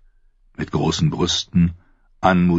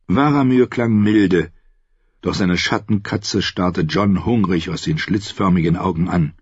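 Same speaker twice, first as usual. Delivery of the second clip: normal talking voice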